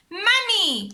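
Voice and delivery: high, loud call; crying out. A narrator's reading. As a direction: call Mummy to the sea. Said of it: "cried Peppa".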